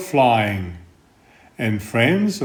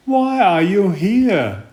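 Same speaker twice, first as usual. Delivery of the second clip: higher owlet voice, wondering; curious little owl; 6 semitones higher than usual. Acted. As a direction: ask like a small surprised bird. Owl asks inside the house.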